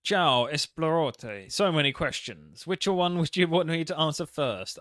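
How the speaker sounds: slow, hammed-up Italian accent for the greeting. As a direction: play the Italian mobster, greeting with a drawn-out Ciao. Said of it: "you gotta do an Italian accent".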